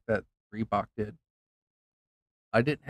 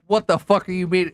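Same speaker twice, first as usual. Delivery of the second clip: angry outburst; 6 semitones higher, 7 dB louder than usual. Retold or imitated. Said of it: "I was like".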